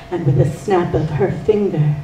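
plain reading voice

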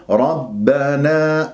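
ربنا ending on a long open aa; stopping with madd. Shown as a demonstration. Teacher showing the pause form, final alif held. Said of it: "ما الطبيعي".

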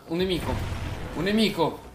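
P=A; enemy callout. Real, not acted. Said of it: "repeats callout, rising".

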